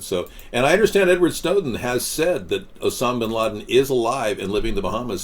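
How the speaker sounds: usual speaking voice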